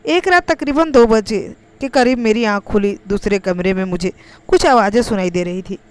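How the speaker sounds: ordinary delivery, nothing marked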